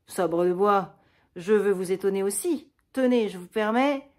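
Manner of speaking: colère de comédie, bien plus forte; s'emporter pour rire; fureur bouffonne. Acted. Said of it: "Avec une fureur bouffonne". (fausse fureur pour taquiner la sœur, sonore)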